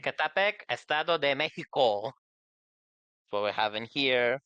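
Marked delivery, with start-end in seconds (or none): 0.0-2.0 Mexican Spanish pronunciation of place names; Spanish accent. Shown as a demonstration.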